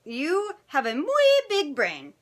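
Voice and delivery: slow, high emphatic character voice; admiring Chihuahua. Acted. Paquito Tito reassuring Scipito, slow high praise. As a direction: stretch it out in a high, Spanish-flavoured voice.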